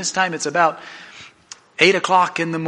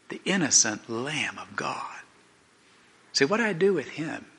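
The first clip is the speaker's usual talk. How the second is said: hushed question; 9 dB quieter than usual. Retold or imitated. Pilate asks the mob about Jesus, hushed. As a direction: let the voice sink almost to a whisper.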